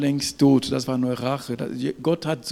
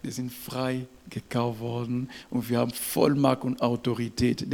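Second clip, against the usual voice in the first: ordinary talk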